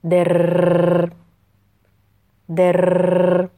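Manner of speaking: rolled R like a toy gun's burst; trilled machine-gun R. Shown as a demonstration. Teacher imitating a child's toy gun to drill the trilled ر. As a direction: roll the ر in در hard like a rattling machine gun.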